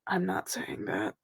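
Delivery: quiet aside under the breath; muttered under breath. Acted. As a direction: mutter it to yourself. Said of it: "under her breath".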